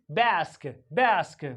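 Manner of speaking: BASK as 'baesk', front 'ae' vowel; American A. Shown as a demonstration. Teacher drilling American English, 'ae' in BASK. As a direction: say bask with the A of cat. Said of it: "in American English".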